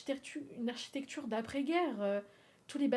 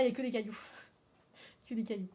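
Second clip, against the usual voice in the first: ordinary talk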